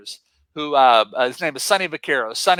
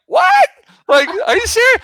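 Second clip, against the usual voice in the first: high, loud squeal of disbelief; can't believe he'll meet Michael Jordan; shocked disbelief; 7 dB louder than usual. Retold or imitated. Told Michael Jordan is here, stunned and loud.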